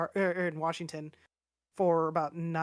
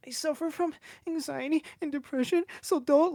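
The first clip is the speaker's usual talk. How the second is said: high, mocking voice of a self-pitying complainer; mocking whiny complainer; 10 semitones higher than usual. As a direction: parody someone hiding behind their diagnosis, pitched way up.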